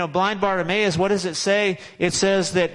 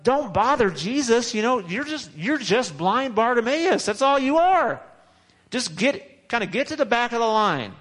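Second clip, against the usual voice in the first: higher, mocking put-down; dismissive crowd; 6 semitones higher than usual. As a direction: brush him off to the back of the line.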